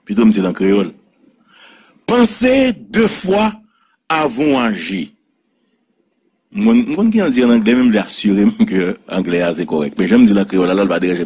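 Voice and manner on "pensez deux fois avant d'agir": slow, high emphatic proverb start; preacher-like maxim. Shown as a demonstration. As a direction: lay the saying down slowly. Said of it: "Proverbe créole".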